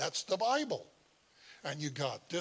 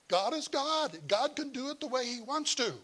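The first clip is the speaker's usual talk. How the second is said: emphatic high retelling; 8 semitones higher than usual. Retold or imitated. Preacher voicing himself to Paul.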